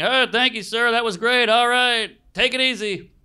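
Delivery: polite meeting sign-off. Retold or imitated. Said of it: "a decent meeting".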